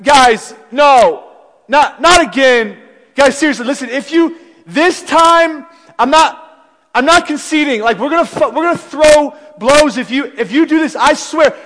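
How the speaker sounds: loud, high warning yell at the pranksters; angry shout. Retold or imitated. Roommate yelling at friends not to dump water, angry shout. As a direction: bark out a threat, fed up and loud.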